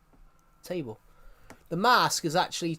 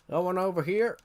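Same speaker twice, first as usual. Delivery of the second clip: ordinary delivery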